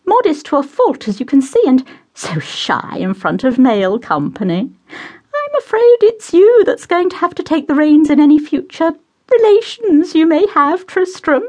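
high, fluttery mother voice; high, gushing matron; doting society mother. Acted. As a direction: play the gushing hostess pouring tea.

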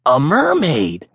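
stunned by the tale; gasped. A narrator's reading. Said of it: "gasped one of the merchants".

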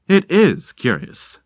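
slow, musing; drawn out, pondering; thoughtful. Acted. Stranger muses about Rickert's story, slow and thoughtful. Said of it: "the man said thoughtfully".

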